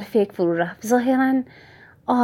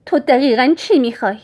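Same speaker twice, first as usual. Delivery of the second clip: high, loud, sharp interruption; mother interrupts the villain, loud and sharp; cutting demand; 5 semitones higher, 7 dB louder than usual. Acted. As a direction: cut in hard and demand it. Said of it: "حرفمو قطع کرد و گفت".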